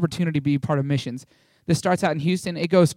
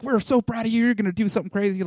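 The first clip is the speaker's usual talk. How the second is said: gushing praise; 6 semitones higher than usual. Retold or imitated. Friends cheering him on.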